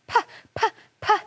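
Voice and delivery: high lip-smack "puh, puh, puh". Acted. Fairy sister smacking her lips at the fufu. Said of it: "smacked her small lips".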